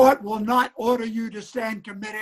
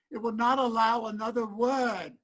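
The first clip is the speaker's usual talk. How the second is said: ordinary talk, nothing marked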